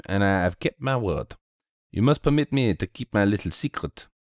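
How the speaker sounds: put-on courteous French-accented sleuth; lightly voiced, lilting Belgian gentleman; Belgian detective, gracious. Detective returns the diamond to the lord, graciously.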